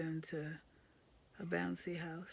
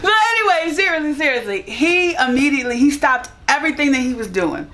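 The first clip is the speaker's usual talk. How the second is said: much higher and louder, still giddy; loud excited gush; 6 semitones higher, 24 dB louder than usual. Real, not acted. Wife praising husband to camera, loud giddy gush. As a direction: gush about him, still buzzing from laughing.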